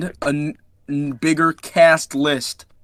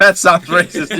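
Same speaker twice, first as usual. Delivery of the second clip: laughing; 6 dB louder than usual. Real, not acted. Laughing mock outrage at joke.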